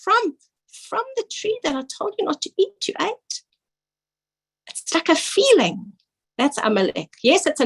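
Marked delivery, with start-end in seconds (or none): 0.0-3.4 high, wondering question rising on "ate"; questioning inflection. Shown as a demonstration. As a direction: pitch it high and leave "ate" hanging as a question. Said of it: "it's a breath".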